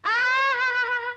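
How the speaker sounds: slow drawn-out mocking aha-ha-ha; mocking laugh. Sister-in-law mocking the devoted wife, slow scornful laugh. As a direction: laugh slowly to ridicule her.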